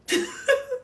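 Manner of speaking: high laugh. Real, not acted. Laugh after confession.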